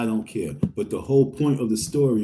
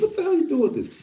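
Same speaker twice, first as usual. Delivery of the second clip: shocked question; faster than usual. Retold or imitated. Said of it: "I was like".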